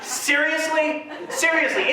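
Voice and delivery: high, slow incredulous repeat; can't believe what she said; stunned disbelief. Retold or imitated. Dad hearing the insulting offer, high stunned disbelief. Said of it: "I'm like".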